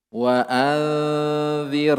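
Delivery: Wa anzir drawn out slowly, dzal kept thin; thin recitation. Shown as a demonstration. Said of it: "jangan lagi ditebalkan". (bacaan tipis, pelan)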